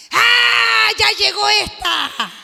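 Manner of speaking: loud, high possessed shriek; possessed relative shrieking. Acted. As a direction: screech it out like something startled. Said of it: "algún pariente va a saltar".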